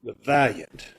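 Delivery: a condemned man, quiet and resigned; condemned prisoner. Acted. Condemned man saying goodbye to the girl, resigned. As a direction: play the prisoner facing death, low and hushed. Condemned man's last scene.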